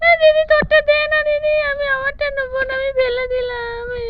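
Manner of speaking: put-on high childish voice, whining toward tears; whiny little brother. Little brother whining to sister for her toy gun, high and tearful. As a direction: sulk and whimper like a small boy.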